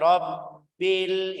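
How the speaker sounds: slow Rabbil, bb pressed and held; held double b. Shown as a demonstration. Teacher stretches Rabbil to show two letters. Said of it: "ini jadi dua".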